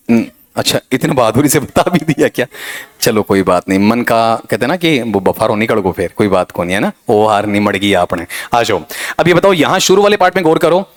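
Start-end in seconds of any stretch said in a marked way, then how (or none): none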